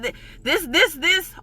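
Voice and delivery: ordinary talk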